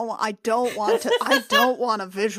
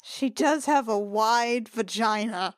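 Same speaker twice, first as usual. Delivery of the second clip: ordinary talk, nothing marked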